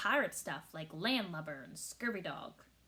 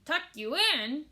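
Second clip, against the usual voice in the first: louder, higher outraged bellow; 5 semitones higher, 6 dB louder than usual. A narrator's reading. Captain outraged at bedtime request, bellow. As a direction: bellow the question in disbelief. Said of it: "he bellowed".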